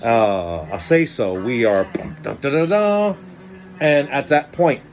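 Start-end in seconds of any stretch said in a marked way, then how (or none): 1.8-3.2 dramatic dun dun. Pretend. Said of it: "dramatic sound-effect chant".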